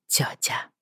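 whispered under the breath; muttered. A narrator's reading. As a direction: murmur it to yourself. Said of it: "пробормотала Бриана".

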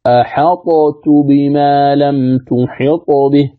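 slow أحطت بما لم تحط به with full ط; unused reading. Shown as a demonstration. Teacher showing a reading nobody uses, slow. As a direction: recite أحطت keeping the ط unmerged. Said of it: "لم يقرأ بها أحد".

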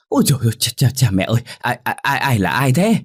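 high-pitched, stammering fright; startled yell. A narrator's reading. Maid startled by a tap on her shoulder, startled yelp. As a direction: jump out of your skin, stuttering who's there. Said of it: "làm bà giật bắn mình hét lớn".

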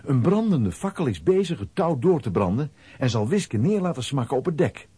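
ordinary delivery

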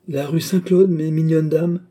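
a jolly baker calling out; cheerful voice. A narrator's reading. Baker calls out to the lost ladies, jolly and loud. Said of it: "dit une voix enjouée".

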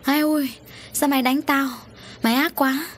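high-pitched wail, not shouted; plaintive acted protest at being hit; pained outcry. A narrator's reading. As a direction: cry out like a hurt madwoman. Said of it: "Út Duyên kêu lên".

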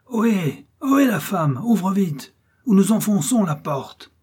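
high, loud hail from outside; angry shouting. A narrator's reading. Brigands shouting through the door to the farmer's wife, angry demand. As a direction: call out roughly, demanding entry. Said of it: "de grosses voix enrouées et colère se font entendre".

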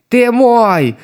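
high, loud attacking shout; battle cry. A narrator's reading. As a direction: yell it as you charge.

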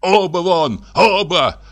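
an enraged doctor losing control; furious shout. A narrator's reading. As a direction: drive them both out, shouting. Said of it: "продолжал кричать Андрей Ефимович".

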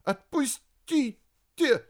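high, loud, drawn-out yelp; pained cry. Acted. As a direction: cry out as the grip tightens. Kostya cries out in pain. (вскрик от боли)

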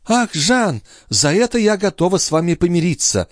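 high-pitched, gushing countess; delighted exclamation. A narrator's reading. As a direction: exclaim as if forgiving him gladly. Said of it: "воскликнула графиня".

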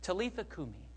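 softly spoken, tender words; hushed tenderness, as to a child; quiet and gentle. Acted. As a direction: say it gently, well below speaking level. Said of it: "he said it gently".

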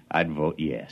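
soft low romantic aside; low intimate murmur. Acted. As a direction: murmur it near her ear. Suitor voting for marriage.

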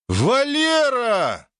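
very high, slow, joyous shout; joyful yell. Acted. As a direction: yell his name, delighted. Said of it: "радостно заорав".

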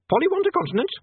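very high parrot voice. A narrator's reading. Parrot asking Polly's question, very high parrot voice. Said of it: "said the parrot".

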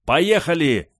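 high, loud urgent command; shouted order. A narrator's reading. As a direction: yell to get everyone moving. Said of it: "закричала Индия".

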